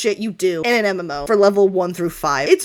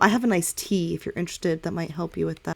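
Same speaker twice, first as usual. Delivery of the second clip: ordinary delivery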